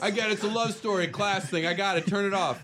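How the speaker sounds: high, put-on rich guy voice; billionaire voice. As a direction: play a dismissive billionaire. Voicing Titanic billionaire.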